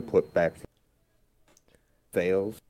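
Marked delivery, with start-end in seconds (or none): none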